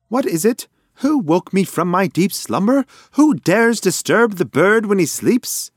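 high, loud indignant bird; mock-grand indignant pigeon, high and loud; pompous outrage. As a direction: thunder like a king disturbed from sleep.

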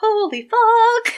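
high, loud, drawn-out disbelief; exaggerated slow exclamation; acted shock. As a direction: stretch it out, stunned and high.

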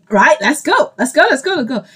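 playful impatient pep talk; eager urging. Acted. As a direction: hurry them along, voice lifting with each repeat. Plays the Lord urging her on.

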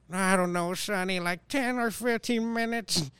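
high, dragged-out put-on old voice; old person voice. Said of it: "they'll have, like, old people voice things".

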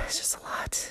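weary whisper after a sigh. Real, not acted. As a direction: let it out in a tired whisper. Tired sigh then under breath.

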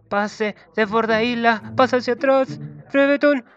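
screaming goal call over the play; goal commentary. Acted.

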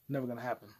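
usual speaking voice

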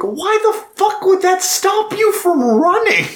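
high, incredulous mock outburst; mock exasperation. Podcaster mocking the soldier's excuse, high incredulous squeal. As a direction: squeal it in disbelief. Speaker's own mocking reaction.

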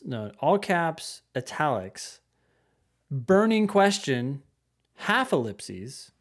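slow, emphatic reading with separated phrases; deliberate email read-out. Acted. Host reading a listener's email with punctuation aloud, slow and deliberate.

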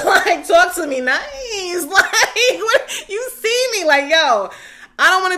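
high, loud giggling brag; laughing. Real, not acted. Giggling loudly to her viewers about her price going up. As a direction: laugh your way through the brag. Cackling while bragging.

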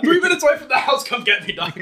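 high, loud urgent plea. Retold or imitated. Son urging stepdad to come, high and loud. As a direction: hurry him, voice up high. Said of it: "I'm like".

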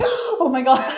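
high, loud reenacted gasp of awe; amazed outburst. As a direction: relive the shock, loud and laughing. Reenacts own reaction to the show.

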